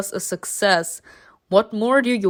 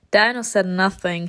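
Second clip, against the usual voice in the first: plain reading voice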